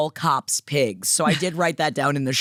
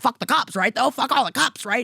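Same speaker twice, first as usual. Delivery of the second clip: higher, cheeky chant against the cops; playful mock rant; 7 semitones higher than usual. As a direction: tease the young man playfully, pitched up. Joking to the counter guy.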